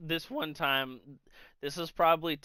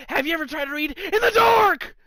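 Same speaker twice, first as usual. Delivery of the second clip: high, loud yell building to a scream; raging; 11 semitones higher, 8 dB louder than usual. Retold or imitated. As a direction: imitate an exasperated friend yelling in a rage. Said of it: "he's fucking raging".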